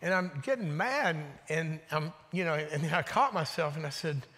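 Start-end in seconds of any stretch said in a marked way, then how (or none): none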